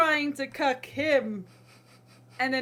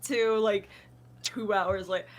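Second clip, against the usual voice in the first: usual speaking voice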